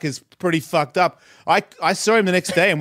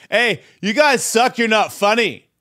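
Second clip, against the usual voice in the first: slow, raised heckling call; higher, drawn-out jeer; imitated heckler; 7 semitones higher, 3 dB louder than usual. Man sent up to insult the performers, slow heckle.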